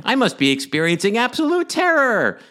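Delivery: very high, loud manic villain; shrieking with unhinged glee; mad scientist. Retold or imitated. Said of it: "He's like".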